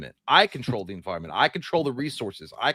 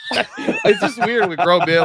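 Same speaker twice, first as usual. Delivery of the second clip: slow, loud laughing words, pitched way up; laughing shout; 11 semitones higher, 8 dB louder, slower than usual. Real, not acted. Embarrassed friend, laughing about grown men's wishes, loud laugh. Laughing at awkward Father's Day.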